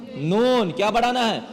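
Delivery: ordinary talk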